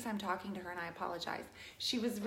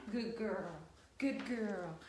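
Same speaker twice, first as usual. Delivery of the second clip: talking to a dog; 3 semitones lower, 4 dB quieter, slower than usual. Real, not acted. Praising the dog.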